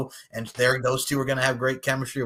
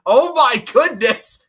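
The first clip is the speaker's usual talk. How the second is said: slow, loud, high gush; excited; 10 semitones higher, 9 dB louder, slower than usual. Real, not acted. Host hyping the main event, slow excited gush. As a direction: savour the excitement.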